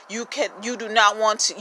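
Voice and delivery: ordinary talk, nothing marked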